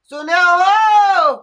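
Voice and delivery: slow, loud, high call; drawn-out loud call. Acted. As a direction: call across the house, stretching it. Old woman calls out to someone.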